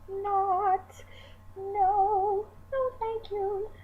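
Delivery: high, prim refusal; mock offended, high and dainty; indignant. Rejecting escort service idea.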